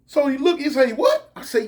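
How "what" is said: a sudden high squeak of confusion; surprised. Retold or imitated. Inmate squeaking in confusion at the plan. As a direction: shoot the word way up in shock.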